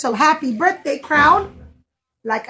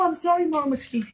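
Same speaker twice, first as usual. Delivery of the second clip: normal talking voice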